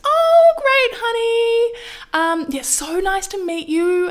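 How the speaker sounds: high, slow, sugary brush-off; airy fake-cheerful art director voice; fake-sweet director. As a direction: gush fake niceness to dismiss her. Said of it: "And she goes".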